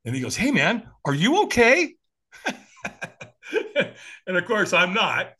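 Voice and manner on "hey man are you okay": higher, louder checking-in voice; concerned stranger. Retold or imitated. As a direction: check on someone who looks off.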